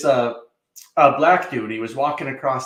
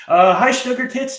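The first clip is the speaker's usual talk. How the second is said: bright, cheeky hello pitched way up and loud; playful high greeting; 11 semitones higher, 6 dB louder than usual. Acted. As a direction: sing out the hello like teasing a friend. Greeting a viewer in chat.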